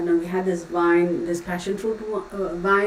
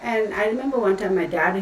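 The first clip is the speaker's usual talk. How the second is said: ordinary talk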